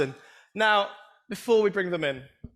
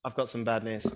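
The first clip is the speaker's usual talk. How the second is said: much lower and softer, solemn and heavy; grave bad-news delivery; 8 semitones lower, 7 dB quieter than usual. Shown as a demonstration. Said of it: "Last time".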